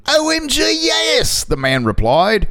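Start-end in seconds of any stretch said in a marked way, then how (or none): 0.1-1.4 excited. Retold or imitated. Quoting excited man.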